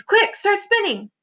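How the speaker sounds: high-pitched hurried command; urgent yelled order. A narrator's reading. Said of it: "yelled James".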